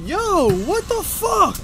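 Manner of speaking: high, shocked outburst; outraged. Real, not acted. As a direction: yell in disbelief at the driver. Host outraged at bus driver.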